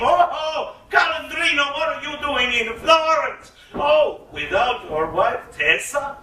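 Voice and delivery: high, bright-eyed fake astonishment; mock surprise. A narrator's reading. Tricksters greeting their friend with faked surprise. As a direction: put on a show of surprise. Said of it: "acted all surprised".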